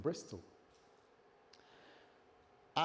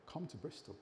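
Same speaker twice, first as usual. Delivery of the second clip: soft, hushed command from God; a quiet summons, barely above a murmur; God's quiet call; 13 dB quieter than usual. Retold or imitated. God calling him to Bristol.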